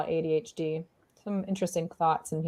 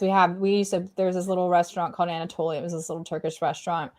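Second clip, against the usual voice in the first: ordinary talk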